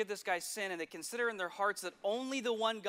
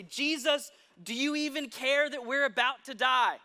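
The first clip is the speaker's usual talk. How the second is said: higher, louder panicked cry to Jesus; terrified plea; 4 semitones higher, 6 dB louder than usual. Acted. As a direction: disciples in the storm begging Jesus, panicked. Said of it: "they're terrified".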